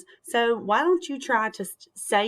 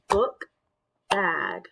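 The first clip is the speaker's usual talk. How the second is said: BOOK, pause, BAG; syllable by syllable. Shown as a demonstration. Teacher clapping the next word, "book bag" split in two. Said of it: "Two syllables".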